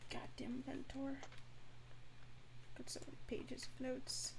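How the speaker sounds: unvoiced under-breath whisper. Real, not acted. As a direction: whisper it to yourself, annoyed. Muttering to self over notes.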